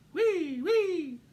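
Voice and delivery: high loud siren, short of breath; gasped siren. A narrator's reading. Stuck mouse gasping out his siren, high and loud. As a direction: push out the siren wail breathlessly. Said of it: "he managed to gasp".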